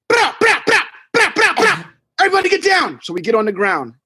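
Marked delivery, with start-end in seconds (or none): 0.1-1.9 high, sharp mouth gunfire bursts; imitated gunshots. 2.2-3.0 a high yell to hit the floor; panicked warning shout. Retold or imitated. Yelling warning during shooting.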